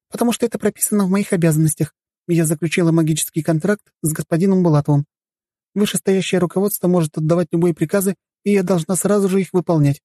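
very high-pitched, dutiful maid; obedient servant girl. Acted. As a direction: recite your duties as an obedient maid. Voices the servant Elena explaining. (исполнительная служанка, высоким голосом)

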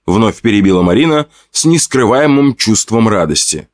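ordinary delivery

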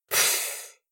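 soft breathy cat snort; a dismissive little cat huff. A narrator's reading. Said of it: "фыркнула Ночка".

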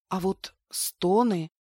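plain reading voice

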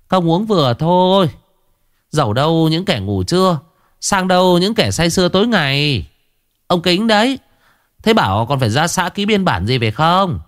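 high, sharp scolding wife voice; nagging wife. Acted. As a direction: scold him from the kitchen doorway. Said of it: "bà Mến, vợ ông Kính từ trong bếp đi ra".

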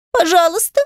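high, pleading whimper; sobbing plea. Acted. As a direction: beg through a sob. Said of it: "всхлипнула девочка".

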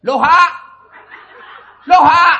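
very loud, high comic bellow; hammed-up phone holler; shouted call. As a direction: blast the garbled hello down the phone. Caller shouts backwards hello into phone.

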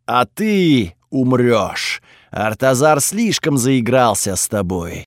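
slow, looming threat from inside a helmet; menacing god voice. Acted. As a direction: loom over them, each word weighed. Sun god turns on hero.

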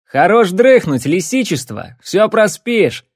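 loud, slow teasing call; loud wake-up call. Acted. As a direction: rouse a sleepyhead loudly. Waking a sleeping friend loudly.

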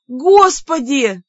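slow, high, loud wail; drawn-out exasperated cry. A narrator's reading. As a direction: appeal dramatically to the heavens. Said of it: "возопила я".

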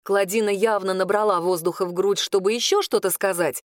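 usual speaking voice

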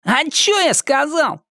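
наигранно невинно, очень высоко; театрально изобразить невинность; громко, как в театре. A narrator's reading. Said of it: "Громко, как в театре". (театрально, напоказ)

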